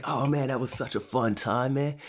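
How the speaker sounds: high, whooping party-goer voice; mock reveler back from drinking; partied-out vampires. Vampires coming home from partying, high and merry. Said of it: "just came back from a night out".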